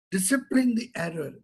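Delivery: ordinary delivery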